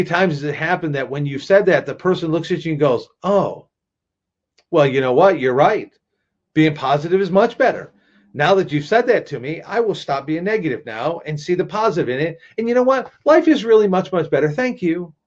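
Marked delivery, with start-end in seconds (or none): 3.2-15.1 high, over-sweet mock conversion; sarcastic agreement. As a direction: fake a cheerful change of heart, sarcastically. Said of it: "the sarcasm and just having".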